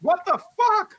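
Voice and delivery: high, loud disbelief; shocked outburst. Real, not acted. Player reacts to the roll, loud high disbelief. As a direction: burst out, pitch shooting up.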